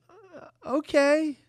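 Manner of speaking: high, slow, grudging agreement; reluctant mock okay. Margaret grudgingly agreeing to one date, slow and high. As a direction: drag it out unwillingly.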